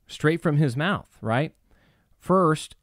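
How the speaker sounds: usual speaking voice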